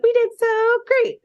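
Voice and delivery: put-on very high, squeaky little-girl cheer; celebrating girls. Powerpuff Girls high-fiving each other, squeaky mock celebration. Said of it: "high-fiving each other".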